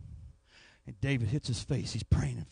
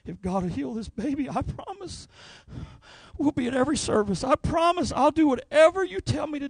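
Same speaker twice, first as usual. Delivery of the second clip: put-on high, rising, desperate plea from a scared young dad; pleading young father; 12 semitones higher, 6 dB louder than usual. Father begging the preacher to heal his baby, high desperate pleading. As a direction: beg the preacher as a frightened new father, voice climbing higher and louder. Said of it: "He looks at me, he said".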